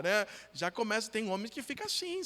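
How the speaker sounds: normal talking voice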